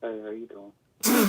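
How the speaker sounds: low, slow and smooth; sexy voice. Real, not acted. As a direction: lay on the slow seductive charm. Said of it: "we got a sexy voice".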